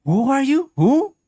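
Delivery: high, loud incredulous questions; shocked demand. Real, not acted. Streamer yelling at a character who threw Child. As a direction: confront her in disbelief.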